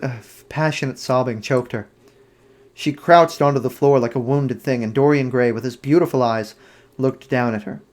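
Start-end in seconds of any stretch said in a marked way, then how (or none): none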